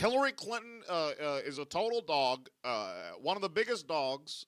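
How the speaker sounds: halting presidential speech, phrases falling in pitch; Barack Obama. Acted. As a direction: stretch out the uhs between measured phrases. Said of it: "but in Barack Obama's voice".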